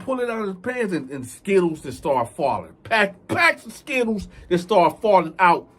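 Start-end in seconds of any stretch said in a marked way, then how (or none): none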